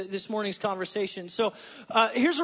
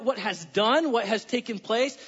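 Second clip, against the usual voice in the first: ordinary talk, nothing marked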